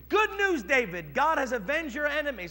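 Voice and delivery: high, loud good-news cry; a messenger shouting glad news; triumphant announcement. Acted. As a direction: call out the victory. Messenger announcing Absalom's death.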